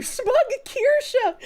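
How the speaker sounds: high, slow words broken up by laughter; laughing. Real, not acted. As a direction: crack up high over the nickname. Laughing at chat's nickname.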